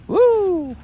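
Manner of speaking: very high delighted woo; gleeful whoop. A narrator's reading. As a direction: whoop while bouncing. Roo bouncing high.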